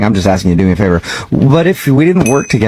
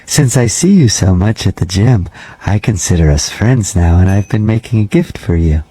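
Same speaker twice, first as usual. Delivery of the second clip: measured, slightly lowered janitor voice; lightly voiced creepy janitor; 4 semitones lower than usual. Night janitor cold-calling a gym member, lightly creepy voice. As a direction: play a polite, oddly intimate night janitor, understated.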